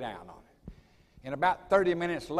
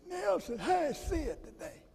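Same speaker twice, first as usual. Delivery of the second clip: much higher, slow and soft, the friend's voice; high mimicking voice; 13 semitones higher, 3 dB quieter, slower than usual. Sid imitating Sterling quoting Nell, high and slow. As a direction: stretch it out in his high voice. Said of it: "It's the way he talked".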